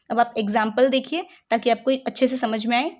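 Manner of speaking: usual speaking voice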